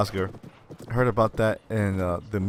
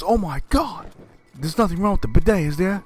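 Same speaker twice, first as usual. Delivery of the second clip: higher, louder worried dad; alarmed father; 10 semitones higher, 5 dB louder than usual. Retold or imitated. Father worried about the bidet, higher alarmed voice. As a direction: gasp it out in mock alarm.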